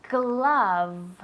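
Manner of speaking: glove drawn out very slowly, open vowel and a clear v at the end; slow correction. Shown as a demonstration. Teacher correcting Ángeles, saying glove very slowly. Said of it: "Se dice".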